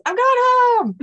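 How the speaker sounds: high, loud talking-dog voice. Acted. Another dog says it, high and loud. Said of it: "another dog says".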